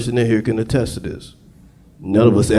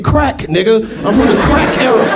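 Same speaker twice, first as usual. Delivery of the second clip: proud declaration; 10 semitones higher than usual. Retold or imitated. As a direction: announce it proudly. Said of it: "I said".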